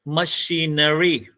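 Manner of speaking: "machinery" slowly as ma-shee-na-ree; syllable by syllable. Shown as a demonstration. Teacher confirming four syllables, "machinery" slowly divided ma-shee-na-ree. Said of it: "So there are four syllable".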